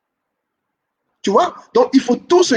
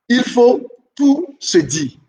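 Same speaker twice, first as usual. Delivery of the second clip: slow emphatic rule; 3 semitones higher, much slower than usual. Shown as a demonstration. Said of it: "teacher drawing out key rule".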